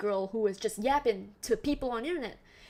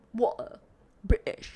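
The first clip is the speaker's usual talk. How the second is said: mock British accent, glottal T in both words; 2 semitones lower, slower than usual. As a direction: put on a British accent, one word at a time. Imitating British glottal pronunciation.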